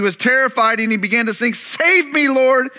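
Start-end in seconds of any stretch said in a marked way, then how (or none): 1.5-2.7 high shouted cry for help; shouted plea. A narrator's reading. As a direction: cry out to be saved from sinking. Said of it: "he shouted".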